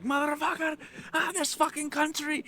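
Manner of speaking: high, strained mock yell; imitated yelling. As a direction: rage like the ranting stranger. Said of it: "he's still yelling".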